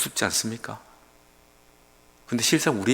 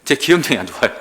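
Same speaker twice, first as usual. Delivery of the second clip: ordinary delivery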